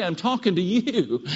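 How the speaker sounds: ordinary talk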